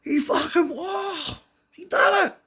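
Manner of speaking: very high, incredulous cry; astounded. Retold or imitated. Big kids running over after the landing, astounded squealing. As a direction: imitate astounded kids, voice shooting way up. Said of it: "They're fucking astounded".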